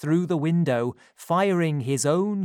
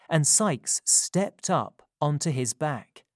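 plain reading voice

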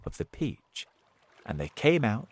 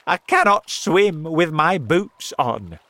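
loud, higher-pitched protest; panicky shout; 6 semitones higher, 8 dB louder than usual. A narrator's reading. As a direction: shout it in a fluster. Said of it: "shouted the centipede".

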